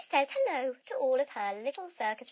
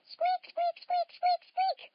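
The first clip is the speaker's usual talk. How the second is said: very high little squeaks; mouse squeaks; slower than usual. Acted. Storyteller squeaking as Meldrum the mouse. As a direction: squeak like a tiny naughty mouse.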